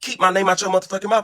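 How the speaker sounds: high, heated retold rant; mock fury at her; heated telling off. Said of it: "I said".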